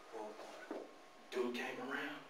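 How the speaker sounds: hushed whisper, dropping almost silent. Acted. Telling the crowd about the big game, in a hushed whisper. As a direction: whisper it to build suspense. Building suspense before big play.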